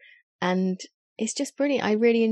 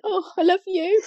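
mock gushing; 11 semitones higher, 5 dB louder than usual. Mocking gushing praise of each other.

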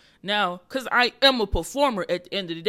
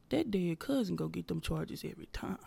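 whispered the first time, like a confession; whispered punchline; 7 dB quieter than usual. Retold or imitated. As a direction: let the joke slip out as a secret. Retelling the joke on stage.

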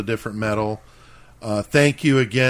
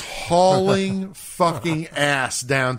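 slowed right down like a TV replay; 5 semitones higher, much slower than usual. Shown as a demonstration. Describing a friend sprinting to the beach, slow-mo. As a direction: narrate it in Baywatch slow motion. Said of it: "Like slow-mo".

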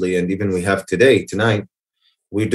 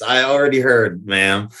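normal talking voice

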